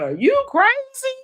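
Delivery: high-pitched mock scream of outrage; Portia screaming out. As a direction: imitate a shrieked, incredulous outburst, pitch way up. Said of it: "Portia screaming out".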